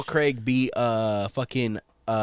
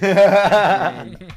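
laughing; 7 semitones higher, 12 dB louder than usual. Real, not acted. Laughing after the ad read.

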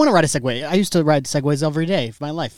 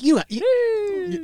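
high drawn-out teasing. Acted.

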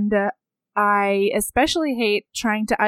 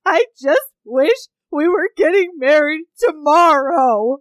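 high, loud, teary plea, slowed down; imitating herself close to tears; tearful wedding stress; 7 semitones higher, 5 dB louder than usual. Retelling crying before her wedding.

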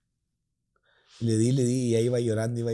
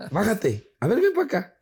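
high-pitched mock command, rising; bossy sister; 9 semitones higher, 4 dB louder than usual. Sister orders girlfriend out of car, bossy. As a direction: imitate a sister summoning someone sternly.

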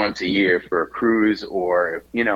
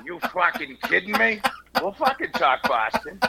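hammed-up Boston accent, pitched much higher; 12 semitones higher than usual. Showing the interviewer his Boston accent, hams up a high Boston accent. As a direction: go full over-the-top Boston tough guy. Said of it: "that Matt Damon, Ben Affleck, bad Boston accent".

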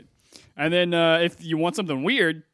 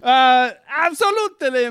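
put-on high foreign-accented host, drawn out; foreign show host; 7 semitones higher, 4 dB louder, much slower than usual. Foreign host introducing the podcast's shows, effusive accented welcome.

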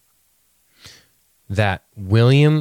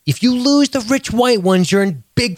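rising higher to a near yell; exasperated rant; 8 semitones higher than usual. Real, not acted. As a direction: build the rant to a peak.

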